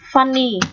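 "funny" with the U as ah; "funny" said alone, U sounded as a short ah; pronunciation demo. Shown as a demonstration. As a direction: model "funny" for learners, vowel like á. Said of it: "U ở đây cũng được phát âm là Á".

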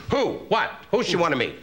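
high, incredulous mock squawk; startled disbelief. Comedian invited to meet royalty, startled and incredulous. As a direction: blurt it out, pitch jumping high.

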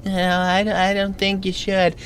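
small, high, timid inner voice; little high-pitched conscience; tiny voice of reason. Acted. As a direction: object meekly in a tiny high voice. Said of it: "tiny little voice of reason in the back".

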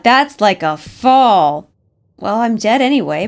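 ordinary talk

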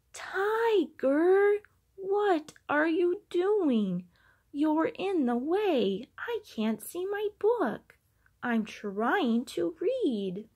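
high, loud, slow exasperated complaint; loud high exasperated child; exasperated shout. A narrator's reading. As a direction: complain loudly that the tiger blocks the book. Child exasperated at blocked book.

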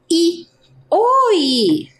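"I" then "Oi" said slowly and clearly as separate sounds; teacher modelling "I", then "Oi"; sounding out letters. Shown as a demonstration. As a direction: model each sound for children. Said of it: "Olha a pronúncia".

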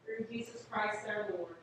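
normal talking voice

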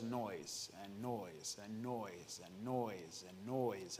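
hushed, stretched, repetitive roll; droning repetition. Real, not acted. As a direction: drag out the list in a lulling drone. Piling up endless noise.

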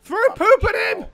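high, loud call for help; urgent shout. Real, not acted. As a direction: yell the order urgently.

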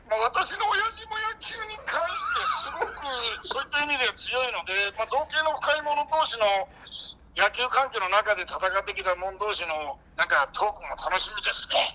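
hammed-up pundit, leaping into high pitch. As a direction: ramble as an impersonated baseball commentator, voice shooting up. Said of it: "掛布正輝です". (掛布のものまねで声を裏返し気味に語る)

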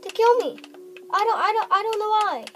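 high, loud frightened cry; scared cry. Real, not acted. Gamer shrieking in fear at being attacked.

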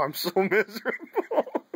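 can't get through the quote without laughing; laughing retelling. Retold or imitated. Retelling grandma's curses at God, laughing.

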